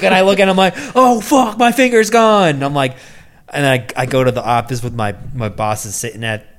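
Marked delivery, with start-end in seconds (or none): 1.0-2.6 high, loud mock wail; hammed-up dismay at the injury; horrified cry. Injured man seeing his finger, horrified cry. Acts out discovering the missing fingertip.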